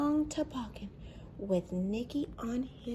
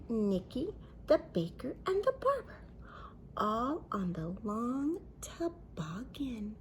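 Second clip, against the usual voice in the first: ordinary delivery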